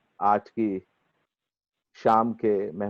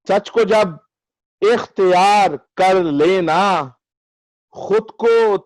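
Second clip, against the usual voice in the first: measured, higher mushaira declamation with lengthened vowels; ghazal recitation; 7 semitones higher, 5 dB louder, slower than usual. Acted. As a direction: recite the verse grandly, drawing out each phrase.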